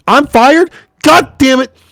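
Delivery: high-pitched comic exclamation; mock outrage. Real, not acted. Commentator learns on stream they are fired, mock outrage. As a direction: exclaim it high in joking dismay. Jokey outburst at being fired.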